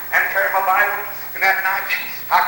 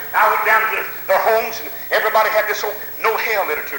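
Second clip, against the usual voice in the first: ordinary delivery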